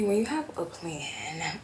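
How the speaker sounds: ordinary talk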